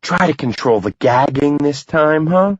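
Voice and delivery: a wife mocking her squeamish husband. A narrator's reading. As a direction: tease him, pitch climbing. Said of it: "She pats him on the back and mocks".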